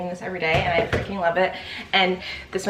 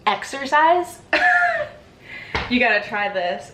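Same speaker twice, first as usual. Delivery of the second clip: mock hype; 3 semitones higher than usual. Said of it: "acting like I'm putting you guys on".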